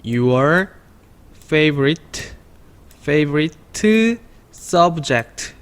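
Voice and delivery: favorite subject stretched very slowly, syllable by syllable; teacher spells out favorite subject slowly; slow pronunciation drill. Shown as a demonstration. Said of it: "발음 좀".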